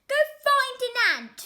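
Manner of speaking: high, loud, fast snap; a boy barking at his pet; dismissive shout; bratty yell. A narrator's reading. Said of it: "the boy replied".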